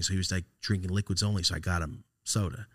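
ordinary talk

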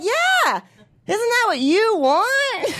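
high, slow mock-innocent reply; mock sweet reply. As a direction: coo it up high, playing innocent. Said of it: "I'm like".

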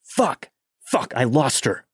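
frustrated outburst, kept at speaking volume; mild mock scream. A narrator's reading. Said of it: "starts screaming".

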